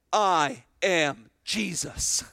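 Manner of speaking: very slow, weighty divine voice; voice of the Lord. Acted. Preacher voicing the Lord answering Saul on the road, slow and weighty. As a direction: let each word land slowly, as the Lord.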